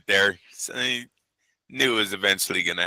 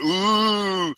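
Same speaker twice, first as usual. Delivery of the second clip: mock crowd ooh; 9 semitones higher than usual. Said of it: "everyone went".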